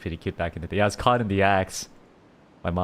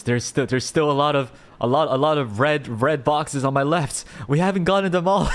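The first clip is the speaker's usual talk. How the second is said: louder and higher, building to a peak; insistent protest; 6 semitones higher, 5 dB louder than usual. Real, not acted. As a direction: plead the case, climbing higher to the end. Playful protest about unopened red boxes.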